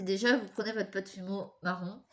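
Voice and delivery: ordinary talk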